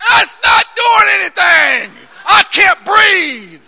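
slow, high, strained yell; hammed-up agonized shouting; winded protest. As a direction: wail it like a player who can't get air. Winded player protests, acted.

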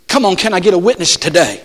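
ordinary talk, nothing marked

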